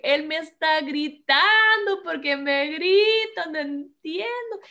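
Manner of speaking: high, slow, dragged-out complaint; upset, distressed. Retold or imitated. Wife re-enacts feeling yelled at by her husband, distressed and high. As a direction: wail it out high and hurt. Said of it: "Yo sentía horrible".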